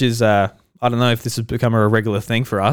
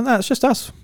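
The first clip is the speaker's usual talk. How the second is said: put-on high girl voice; 11 semitones higher than usual.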